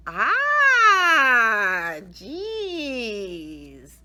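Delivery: very loud, drawn-out moan; teacher bonked on the nose groaning; dismayed groan. A narrator's reading. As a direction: wail it like a defeated teacher.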